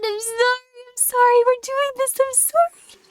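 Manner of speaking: high, squeaky little sorry voice; whiny apology. Pretend. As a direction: plead like a tiny sorry creature.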